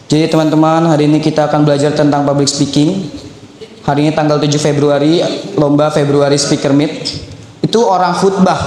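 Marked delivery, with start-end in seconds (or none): 0.1-6.9 lower, monotone speech with no gestures; a dull presenter reading out the intro; stiff no-gesture speech. Shown as a demonstration. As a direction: recite it stiffly like a bland speaker. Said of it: "ada orang yang ngomong kayak gini".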